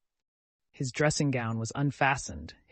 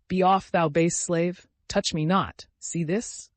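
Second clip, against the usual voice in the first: slow, louder, hammed-up grand shout; grandiose shout; 5 semitones higher, 5 dB louder, slower than usual. A narrator's reading. Drunk old man shouting at the servant, mock-heroic shout. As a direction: bellow like a stage hero. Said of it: "shouted Makar Alexeyevich".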